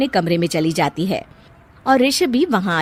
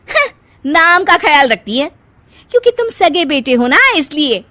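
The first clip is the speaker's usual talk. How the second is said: resentful; 8 semitones higher, 6 dB louder than usual. Acted. Wife complaining to husband.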